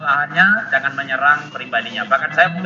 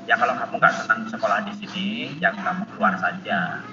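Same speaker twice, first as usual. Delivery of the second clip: dismissive teacher; 8 semitones lower, 5 dB quieter than usual. Retold or imitated. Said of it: "jadi begini".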